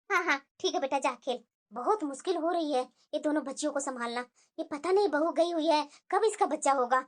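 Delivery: put-on a tired old dadi fretting alone; old grandma. Grandmother muttering worriedly about the delayed grandchild.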